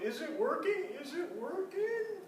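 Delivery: high anxious calls, stretched out; yelled through door. A narrator's reading. Mother calling anxiously through the bathroom door.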